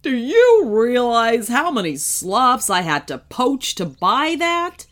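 aggrieved villain. A narrator's reading. Truck owner confronting Kenny after crash, indignant. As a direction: demand it, whining with outrage.

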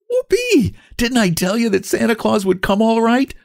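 put-on high, excited child's cheer; delighted shout. A narrator's reading. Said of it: "shouted the delighted Jimmy".

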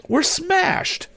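high, dismayed outburst; despairing cry. A narrator's reading. Tom cries to Mister Sharp that they crashed, despairing. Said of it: "something like despair in his voice".

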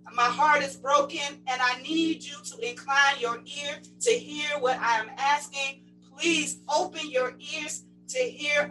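impassioned plea. Acted. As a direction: plead with God on the people's behalf, voice lifted. Preacher voices Nehemiah's anguished prayer.

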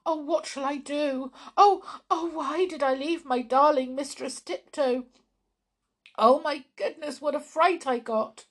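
high, loud, panicky wailing; distraught mouse. Acted. Waffles to Pointy, upset after falling down the chimney. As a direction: fret and wail like a scared little mouse.

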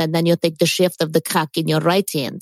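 comic Russian-accented instructions. Shown as a demonstration. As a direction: explain it matter-of-factly in a Russian accent. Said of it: "do a Russian accent".

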